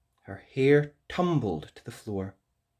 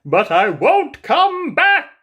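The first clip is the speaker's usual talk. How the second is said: loud, higher, slow sulky threat; prince's parting threat; 13 dB louder, slower than usual. Acted. Prince threatening Rapunzel as he leaves, huffy. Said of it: "said the prince".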